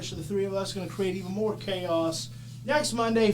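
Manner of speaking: ordinary talk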